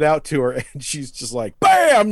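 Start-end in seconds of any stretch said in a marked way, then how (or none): 1.6-2.1 high, loud, punchy exclamation; imitated impact. She attacks with the gnome stick, explosive cry. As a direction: throw it out like a sudden blow.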